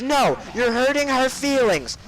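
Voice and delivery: high, drawn-out mock teacher screech; hammed-up shrieky reprimand; scolding teacher. Art teacher rejecting the student's excuse, mock scolding screech.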